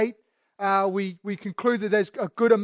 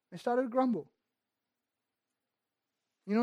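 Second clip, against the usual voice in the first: normal talking voice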